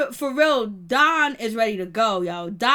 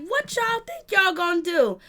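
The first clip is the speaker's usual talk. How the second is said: high, taunting challenge; challenging taunt; 7 semitones higher than usual. Retold or imitated. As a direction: dare them to try. Voicing pissed Don.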